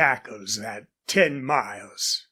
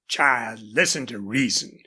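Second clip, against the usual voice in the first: ordinary delivery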